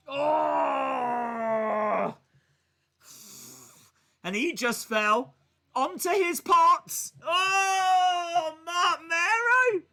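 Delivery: pained, high shouting at the screen; excited shout. Real, not acted. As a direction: yell out in shocked sympathy. Live reaction to Mero's fall.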